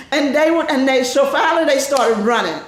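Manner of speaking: normal talking voice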